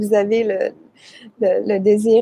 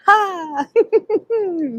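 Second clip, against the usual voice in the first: high laugh; 9 semitones higher than usual. Shown as a demonstration. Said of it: "practicing laughter yoga".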